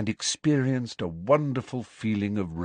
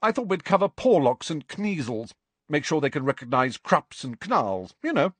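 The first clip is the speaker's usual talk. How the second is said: brisk teacher voice; 7 semitones higher than usual. Acted. Grubbly-Plank voiced in story.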